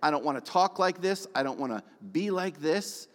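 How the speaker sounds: a pleading shout in a jail cell; crying out to God. Retold or imitated. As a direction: cry out to God from the bottom. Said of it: "I was crying out saying".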